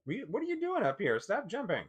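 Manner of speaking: high, sing-song voice for the dog; high pet voice. Real, not acted. Owner tells the jumping little dog off in a high pet voice. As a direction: pitch way up, scolding the dog gently.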